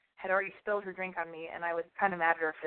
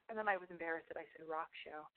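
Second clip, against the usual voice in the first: hoarse, losing her voice; 2 semitones lower, 12 dB quieter than usual. Real, not acted. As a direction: voice giving out, hoarse. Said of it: "I'm totally losing my voice".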